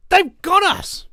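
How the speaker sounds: loud, high-pitched cry; alarmed outburst. Real, not acted. Vlogger realising every country is caught, alarmed outburst. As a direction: burst out with it, voice leaping up.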